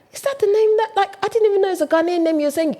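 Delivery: high-pitched, incredulous questioning; baffled. Retold or imitated. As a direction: puzzle aloud over an unrecognisable name. Acts out bafflement at a name.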